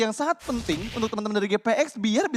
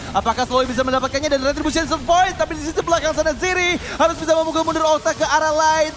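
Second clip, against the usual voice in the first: hyped live play-by-play, louder; esports caster; 7 semitones higher, 5 dB louder than usual. Real, not acted. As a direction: call the teamfight with rising hype.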